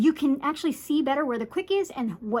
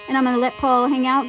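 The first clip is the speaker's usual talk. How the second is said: ordinary talk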